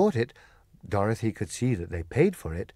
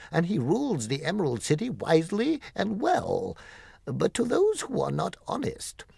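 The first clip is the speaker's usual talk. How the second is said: gatekeeper voice; 11 semitones higher than usual. Acted. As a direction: swell the voice as he praises the terrible wizard. Guardian describes Oz, higher voice.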